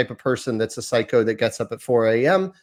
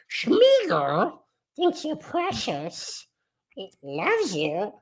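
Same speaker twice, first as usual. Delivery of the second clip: high, slow, drawn-out creature voice; Smeagol; 9 semitones higher, much slower than usual. Shown as a demonstration. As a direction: stretch it out like a creepy cave creature. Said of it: "It doesn't work with Smeagol".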